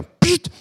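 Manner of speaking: sharp high "psst" poke noise; a quick high hiss for the poke; poke sound "psst". Retold or imitated. Imitating the annoying poke with a sharp high psst.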